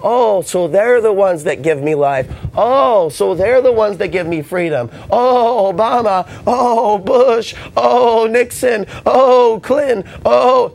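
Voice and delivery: high, loud swooning oh before each name; a pet adoring its masters, high and loud; mock devoted pet. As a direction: gush over each president like a grateful pet. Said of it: "there's some sort of a".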